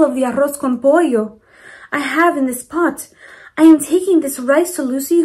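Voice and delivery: lightly voiced grandmotherly neighbor asking; Mama Provi voice. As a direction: voice the kind old lady asking politely. Mama Provi asks for trade.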